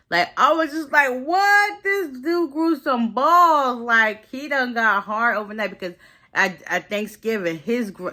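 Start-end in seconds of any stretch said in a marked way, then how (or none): 0.1-5.7 high, loud, drawn-out disbelief; astonished reaction. Real, not acted. Reacting to him growing some balls, astonished. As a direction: react amazed, pitch leaping way up. Excited reaction to retold comeback.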